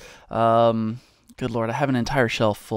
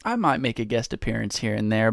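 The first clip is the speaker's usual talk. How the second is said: ordinary talk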